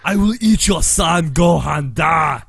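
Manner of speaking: put-on loud shouted Russian accent; evil Russian. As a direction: yell it as a Russian villain. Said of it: "sound like an evil Russian".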